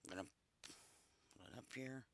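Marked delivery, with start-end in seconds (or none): none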